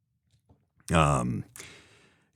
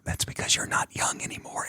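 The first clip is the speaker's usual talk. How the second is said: whisper; 8 dB quieter than usual. Real, not acted. Gentle truth, whispered.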